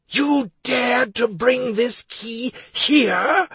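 high, slow, thunderous accusation; booming hermit. Acted. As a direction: boom it out like an enraged ancient hermit. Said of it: "The woman's voice resounded so loudly".

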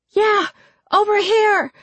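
very high, insistent call; crying out. A narrator's reading. Jessica luring the creature toward herself, crying out. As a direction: call out to draw it toward you. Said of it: "Jessica cried".

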